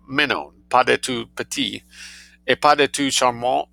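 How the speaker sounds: plain reading voice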